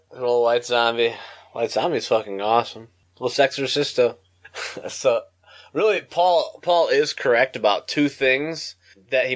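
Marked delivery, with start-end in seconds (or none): none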